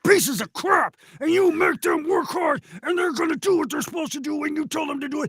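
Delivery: high, loud ranting coach tirade; gruff angry coach. Acted. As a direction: bark at the team like a burnt-out coach. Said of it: "full-on Mathau in the Bad News Bears".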